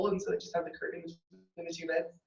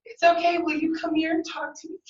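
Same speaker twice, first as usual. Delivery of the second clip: higher, louder voiced lady; 6 semitones higher, 4 dB louder than usual. Lady's reply retold.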